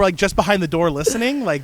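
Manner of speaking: ordinary delivery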